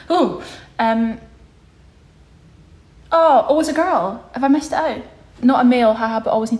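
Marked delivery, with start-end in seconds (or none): 0.1-4.3 higher, louder startled "oh, oh"; surprised. Real, not acted. Realising the viewer is a girl, surprised. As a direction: catch yourself, surprised.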